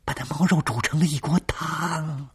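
high, strained and eerie; high, tense storyteller voice. Acted. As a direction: tell it in a high, uneasy voice.

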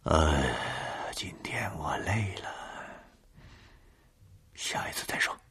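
hushed, ghostly whisper; eerie whisper. Acted. Caller whispering goodbye.

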